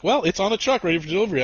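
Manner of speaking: high-pitched mock phone-system voice; automated robot lady. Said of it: "FedEx automated robot lady".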